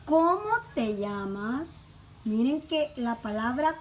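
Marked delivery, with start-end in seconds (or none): 0.0-1.8 slow question, stressed CÓMO, llamas drawn out and rising; slow teacher's model with a lifted, stretched llamas; question intonation. Shown as a demonstration. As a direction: make "¿Cómo te llamas?" unmistakably a question, slowly. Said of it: "que se note que es una pregunta".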